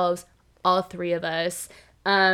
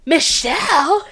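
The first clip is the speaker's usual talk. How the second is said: 1 mock innocent; 6 semitones higher, 5 dB louder, slower than usual. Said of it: "he just immediately goes".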